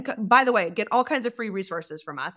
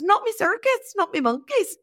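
higher-pitched put-on Irish lilt; Irish accent; 9 semitones higher than usual. Telling a coaching client off nonsense, Irish accent. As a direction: pipe it up in a playful Irish accent. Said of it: "never been able to say it any other way".

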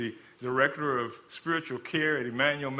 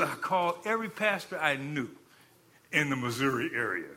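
usual speaking voice

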